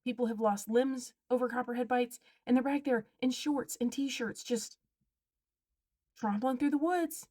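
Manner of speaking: normal talking voice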